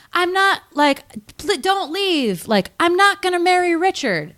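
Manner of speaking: very high-pitched movie plea; pleading heroine. Retold or imitated. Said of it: "And she's like".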